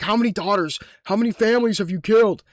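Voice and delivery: mock furious yell. Gamer yelling accusations at a shopping mall, mock fury. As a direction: tear into the mall like it murdered families. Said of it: "I was talking mad shit to the mall".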